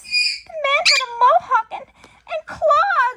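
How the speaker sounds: high, loud, shaken woman's voice; woman witness. Retold or imitated. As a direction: report it like a frightened witness. Woman telling police.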